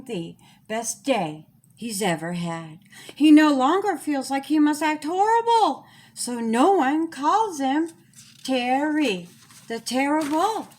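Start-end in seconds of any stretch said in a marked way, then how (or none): none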